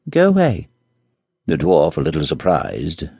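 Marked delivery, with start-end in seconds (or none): 0.1-0.7 higher, brisk "Go away"; a prince shooing off a servant; mild dismissal. Acted. Charming sending the dwarf away, lightly brisk. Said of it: "he said".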